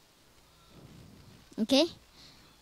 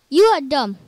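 much louder, slammed on YOU; louder mock scold; 2 semitones higher, 12 dB louder than usual. Gamer scolds himself a second time, much louder. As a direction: bark it back harder.

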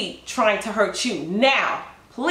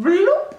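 higher, louder cartoon bloop; comic bloop; 10 semitones higher, 5 dB louder than usual. Narrator imitating a trip with a high loud bloop. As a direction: pop it out like a cartoon fall. Stranger trips and falls.

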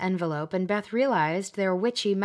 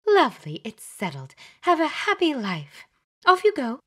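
much higher and louder, giddy witch; gushing Glenda; 8 semitones higher, 6 dB louder than usual. Acted. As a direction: shoo them out cheerfully. Glenda shoos them.

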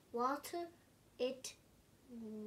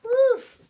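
high, loud barked woof; 11 semitones higher, 19 dB louder than usual. Acted. The puppy arrives, loud barking woof. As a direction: bark it out like a big dog. Reader makes the dog's bark.

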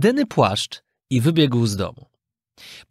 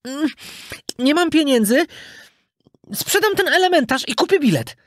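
put-on high child voice thinking aloud; little puppet working out a plan, high-pitched; scheming puppet boy; 13 semitones higher than usual. A narrator's reading. Puppet boy wanting a ticket, high musing voice. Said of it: "pomyślał pajacyk".

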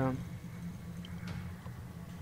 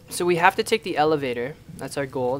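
ordinary talk, nothing marked

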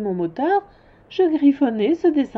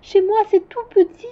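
put-on childlike high; 10 semitones higher, 6 dB louder than usual. Prince's line heard as preview.

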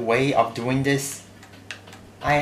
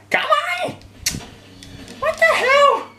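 raging gamer; 3 dB louder than usual. Real, not acted. Failed jump, raging.

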